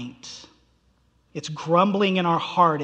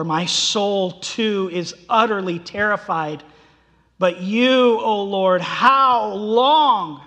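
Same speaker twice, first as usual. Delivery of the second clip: louder, pleading lament; reading the psalm as a real complaint; anguished complaint; 3 semitones higher, 8 dB louder, slower than usual. Acted. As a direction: let the question ache. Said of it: "It's bringing complaint".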